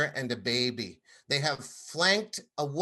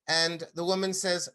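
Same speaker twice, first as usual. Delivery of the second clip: normal talking voice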